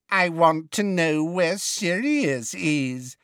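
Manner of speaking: slow, high sneering imitation; mocking mimicry. A narrator's reading. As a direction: mimic him cruelly, drawn out. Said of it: "mimicked the woman".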